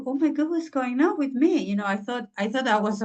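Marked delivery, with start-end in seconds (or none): 0.1-1.6 overwhelmed outcry. Retold or imitated. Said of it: "I was so emotional".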